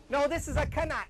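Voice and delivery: usual speaking voice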